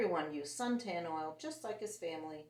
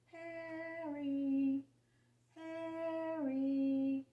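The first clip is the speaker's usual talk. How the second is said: high, drawn-out distant call; calling from afar; 8 semitones higher, much slower than usual. A narrator's reading. Hot dog man calling from a distance, high and drawn out. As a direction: call the name as if from across the beach. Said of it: "heard someone calling his name".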